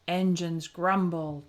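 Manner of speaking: ordinary delivery, nothing marked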